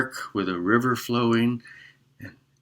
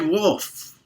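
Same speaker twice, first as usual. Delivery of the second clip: higher desperate call; crying out; 8 semitones higher than usual. Acted. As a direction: call out as the wolf appears. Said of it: "And he cried".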